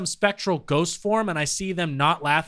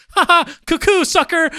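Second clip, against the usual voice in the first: high, loud sing-song jeer; mocking taunt; 13 semitones higher, 5 dB louder than usual. Loved ones laughing at Davey's chicken death, high jeering taunt. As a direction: taunt like a playground bully.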